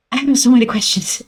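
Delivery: rushed, breathless repeat; fast rush. Shown as a demonstration. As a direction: rattle it off fast.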